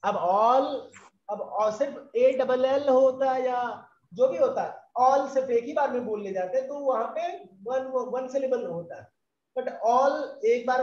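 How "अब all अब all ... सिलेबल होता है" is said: ordinary talk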